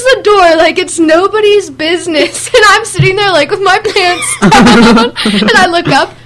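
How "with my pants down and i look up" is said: ordinary talk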